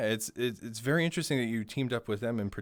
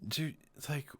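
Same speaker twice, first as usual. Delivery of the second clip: cracking up; 7 dB quieter than usual. Real, not acted. Cracking up at the accent story.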